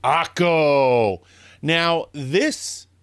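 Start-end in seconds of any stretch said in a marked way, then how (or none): 0.0-1.2 loud build-up to the name; dramatic reveal. Acted. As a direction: tease it, then announce. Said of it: "and this one is on".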